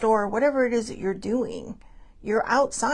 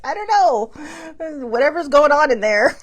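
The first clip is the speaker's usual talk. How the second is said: laughing through the words, higher and louder; 6 semitones higher, 8 dB louder, slower than usual. Real, not acted. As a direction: giggle it out, amused.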